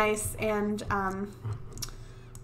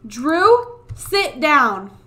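scolding call; 3 semitones higher, 8 dB louder, much slower than usual. Real, not acted. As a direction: bark the name, then the order. Scolding kid, calling out.

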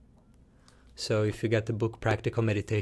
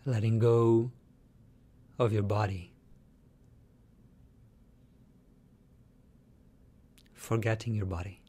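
guided meditation. Shown as a demonstration. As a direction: lead them calmly, letting each phrase settle into silence.

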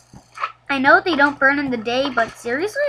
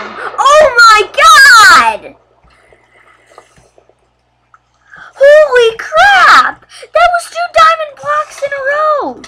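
much higher, much louder ecstatic yelling; excited shout; 13 semitones higher, 12 dB louder, slower than usual. Real, not acted. Gamer shouts at two diamond blocks, ecstatic yell. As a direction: scream with joy at the loot.

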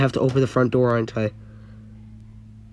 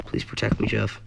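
frightened plea; 5 dB quieter than usual. Real, not acted. Pleading in fear.

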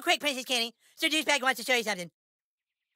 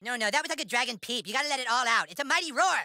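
ordinary talk, nothing marked

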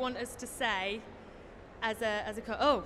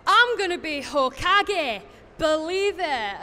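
drawn out in a broad Scottish accent; 3 semitones higher, 8 dB louder, slower than usual. Shown as a demonstration. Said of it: "Scottish accent".

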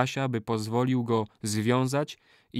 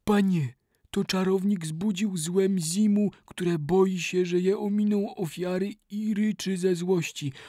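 higher, halting, frightened servant pleading; voice broken by emotion; 7 semitones higher than usual. Acted. Kali warning his master, voice breaking with fear. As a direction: blurt it out shaken and scared. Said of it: "przerywanym ze wzruszenia głosem".

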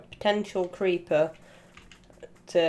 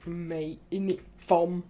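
mock British accent; 2 semitones lower, slower than usual. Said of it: "Being British".

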